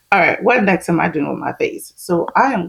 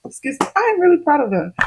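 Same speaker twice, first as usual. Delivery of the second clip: gushing proud reply; 8 semitones higher than usual. Retold or imitated. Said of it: "I was like".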